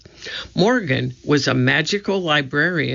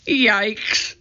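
higher, mildly startled cry; startled exclamation; 7 semitones higher than usual. A narrator's reading. Annie startled by a mouse, mild yelp. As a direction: exclaim in mild alarm, pitch raised.

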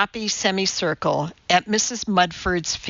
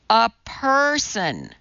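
"A person" drawn out slow and higher; emphasized; 4 semitones higher, slower than usual. A narrator's reading. Teacher glares at Madeline, stressing "A person" slowly. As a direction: lean deliberately on "A person". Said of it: "she emphasized".

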